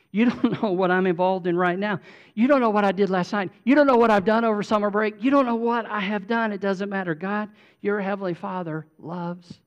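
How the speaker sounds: loud, high, rapid-fire insistence; impassioned preaching. Acted. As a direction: pile it up, urgent and forceful. Preaches at full force.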